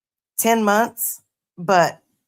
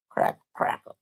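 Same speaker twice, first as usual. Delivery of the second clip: imitated crackle; 7 dB quieter than usual. Shown as a demonstration. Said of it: "An old eight track crackling".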